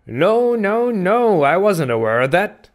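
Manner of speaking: high, wondering stranger's voice; Elijah. A narrator's reading. Stranger answering a charge of impertinence, high and wondering. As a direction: start very high in mock surprise, then ease down. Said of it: "slowly and wonderingly looking".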